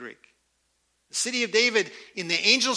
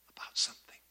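whisper; 12 dB quieter, faster than usual. Acted. Preacher teasing the congregation, whispered. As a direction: drop to a secret whisper. Hushed aside in sermon.

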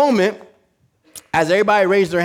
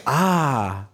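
astonished crowd. Acted. Preacher imitates crowd watching a tightrope walker, astonished. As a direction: imitate an awed audience, lightly. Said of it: "Everybody was astonished".

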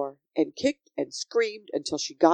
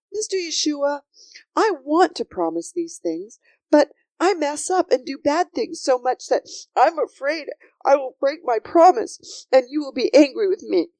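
put-on higher, anxious little-girl voice; worried Sophie; 8 semitones higher than usual. Sophie fears breaking her promise to Yeshua, worried and earnest. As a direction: confess the fear in an earnest rush. Child confesses her fear.

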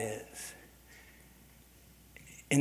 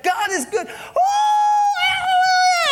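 much higher, much louder, cracking into a cry; ecstatic praise shout; 11 dB louder, slower than usual. Retold or imitated. Preacher praising God by the roadside, ecstatic shout. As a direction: shout hallelujah with everything. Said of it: "I was just going crazy".

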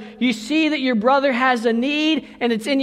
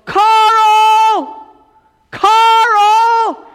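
high, loud call for help; yelling for brother; 11 semitones higher, 9 dB louder than usual. Retold or imitated. Boy yelling to his big brother for help. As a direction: shout the name across to the house. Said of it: "I start yelling".

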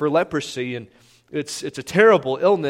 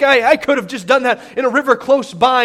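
a touch of indignant complaint, a bit louder; mild frustration; 6 semitones higher, 4 dB louder than usual. Retold or imitated. Naaman complaining about the muddy river, mildly indignant. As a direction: huff like a proud man insulted. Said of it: "He's like".

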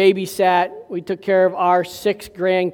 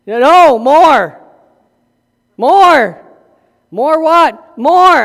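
put-on higher, louder little girl demanding more; insistent toddler; 5 semitones higher, 7 dB louder, slower than usual. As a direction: beg stubbornly like a small child. Grandchild begging for more tickling.

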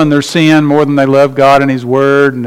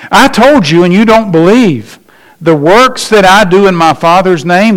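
fervent pulpit delivery; emphatic preaching; 4 semitones higher than usual. Acted. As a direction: thunder the scripture at them. Preaching the text at force.

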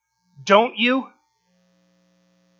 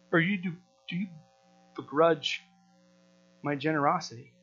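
gentle master; 6 semitones lower, 5 dB quieter than usual. Acted. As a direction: settle the grumblers with quiet authority. Master's calm reply to grumblers.

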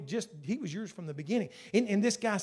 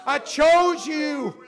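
loud, high, full-force praying aloud; impassioned prayer; 8 semitones higher, 10 dB louder than usual. Real, not acted. Preacher thanking God before the congregation, impassioned prayer. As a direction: cry out to God, drawing out the key words.

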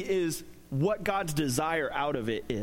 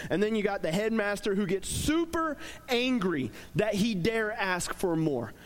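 ordinary talk, nothing marked

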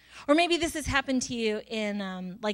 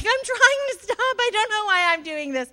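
much higher, loud frantic yell; mock yelling; 11 semitones higher, 7 dB louder than usual. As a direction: cry out that you can't stop yelling. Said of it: "sometimes I'll be yelling".

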